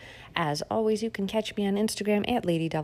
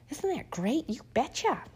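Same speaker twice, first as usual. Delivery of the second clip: sarcastic cheer; 7 semitones higher than usual. Acted.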